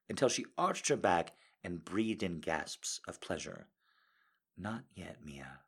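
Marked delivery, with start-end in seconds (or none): 4.6-5.6 low, soft whisper. Acted. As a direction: hush it close to her ear. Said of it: "I whispered".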